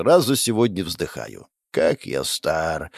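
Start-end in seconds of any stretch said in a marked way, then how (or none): 1.7-2.9 put-on old man groan. Narrator sighs, old man lament.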